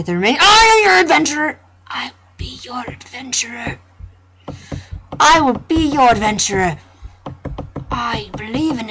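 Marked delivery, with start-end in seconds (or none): 1.9-3.8 whispered vow. Shown as a demonstration. Player quietly promising to be the adventurer, whisper. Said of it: "repeated then loud". 5.2-6.8 louder, proud declaration; declaring. Shown as a demonstration. Player boldly promising to be the adventurer, declaring. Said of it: "repeated loud".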